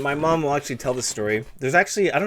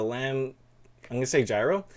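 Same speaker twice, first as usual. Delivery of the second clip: ordinary talk, nothing marked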